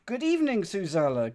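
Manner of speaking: 1 hearty greeting. Real, not acted. Greeting chat with big pitch jump.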